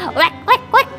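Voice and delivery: playful wek wek duck quack. Retold or imitated. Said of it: "suara ayam itu".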